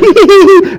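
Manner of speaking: high laughing burst; burst of laughter. Real, not acted. Preacher laughing at his kids hiding, high laugh.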